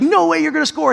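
high mock taunt from his son; a hint of a cocky boy; kid talking smack. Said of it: "He's talking smack".

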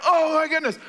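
high-pitched mock squeal of amazement; acted thrill, high but not loud; screaming excitement. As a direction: imitate an astonished crowd, held back. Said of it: "they're screaming and they're shouting".

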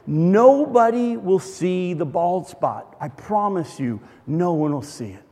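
slowly and gently soothe her; reassuring. Retold or imitated. Calming his panicking wife.

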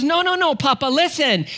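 put-on very high little-girl protest; high-pitched, insistent kid voice; insistent toddler. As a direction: imitate a small child correcting daddy. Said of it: "She goes".

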